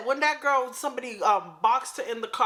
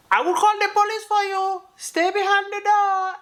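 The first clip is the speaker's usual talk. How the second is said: higher and louder, firmly warning a viewer; raised insistent warning; 10 semitones higher, 4 dB louder than usual. Real, not acted. To a viewer who'd open the door at night, loud high warning. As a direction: call out the rule like a scolding big sister.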